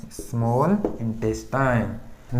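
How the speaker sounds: very slow, low drilled term; teacher drawing out a term to be memorised; slow dictation. Shown as a demonstration. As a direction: stretch each word of small intestine at half speed.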